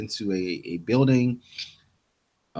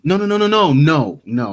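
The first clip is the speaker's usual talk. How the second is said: higher, louder string of no's; loud protest; 9 semitones higher, 8 dB louder than usual. Real, not acted. Cutting off a friend retelling the episode, loud protest. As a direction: stop the story, you got it wrong. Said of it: "We forgot the record store".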